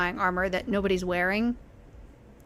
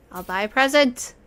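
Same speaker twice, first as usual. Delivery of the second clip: excited; 3 semitones higher, 8 dB louder than usual. Real, not acted. Excitedly picks a shop item.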